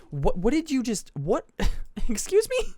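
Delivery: high-pitched mock disbelief; stammer, then squeak up in baffled outrage; incredulous. Mom hearing an absurd excuse from her child, incredulous. Mom's disbelieving reaction, acted out.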